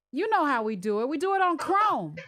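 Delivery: usual speaking voice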